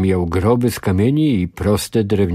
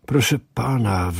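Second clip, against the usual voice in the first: ordinary delivery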